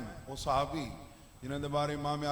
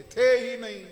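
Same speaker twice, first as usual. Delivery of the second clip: थे ही नहीं punched much louder; stress on थे ही नहीं; 4 semitones higher, 10 dB louder than usual. Real, not acted. Preacher correcting the translation for listeners, थे ही नहीं thrown out loudly. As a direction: hit थे ही नहीं hard and loud. Hammers the key point.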